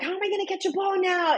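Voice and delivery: put-on high-pitched put-out child; kid's complaint. Mom voicing her son without his glove, high protest.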